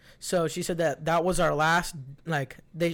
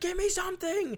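pleading patient. Retold or imitated. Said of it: "She's like".

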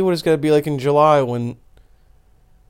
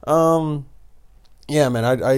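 ordinary talk

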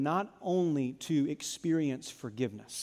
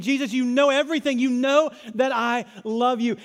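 mildly frustrated, raised insistence; frustrated Peter; 7 semitones higher, 10 dB louder than usual. Acted. Peter pleading with Jesus, mildly frustrated. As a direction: push it out, a touch exasperated. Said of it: "now he's frustrated".

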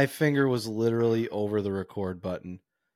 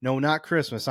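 ordinary talk

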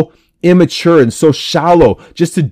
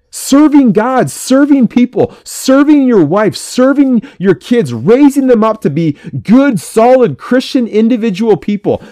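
fired-up preaching; 6 semitones higher than usual. Acted.

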